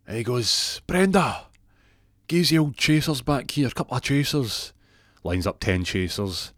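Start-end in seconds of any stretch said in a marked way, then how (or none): 0.9-4.7 loud, high shout to the bar; calling out. Retold or imitated. Jackie orders chasers from Brenda, calling out. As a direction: holler the drinks order across the pub.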